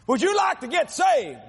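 preacher urging the sinner to come forward; preacher calling out. Retold or imitated. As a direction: call out to the back row. Preacher calling out altar call.

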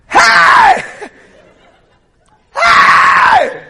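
very high, loud holler; shouted greeting. Retold or imitated. Nephew answering his late aunt far off, shouting. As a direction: shout hey across a long distance.

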